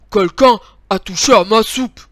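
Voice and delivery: loud, booming big-bear voice. A narrator's reading. Big bear looks at his soup bowl, loud big voice. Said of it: "dit de sa grosse voix".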